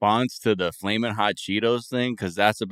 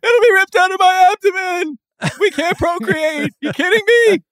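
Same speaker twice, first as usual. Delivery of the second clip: outraged shout; 10 dB louder than usual. Acted. Acted bee outrage.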